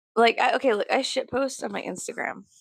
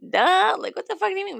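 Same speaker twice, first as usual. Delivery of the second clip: high, incredulous duh; mock exasperation; 11 semitones higher than usual. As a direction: throw it out high as if it's obvious. Mocks the obvious question.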